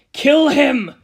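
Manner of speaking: high, loud furious snarl; snarled order. Acted. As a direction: spit the order out in rage. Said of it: "Kira snarled".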